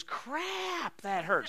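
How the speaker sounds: retold scream. Preacher retells a slapped woman's outburst, mild mock scream. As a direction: imitate a startled outcry without the volume. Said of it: "Screamed it real loud".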